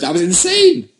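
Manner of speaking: excited. Real, not acted. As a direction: blurt out the shock. Excited about TV episode.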